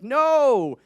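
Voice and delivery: a sudden high, loud shout of no; loud mock no. To the air duct salesman, a loud mock no. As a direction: slam the door on him with one loud word. Punchline rejecting the telemarketer.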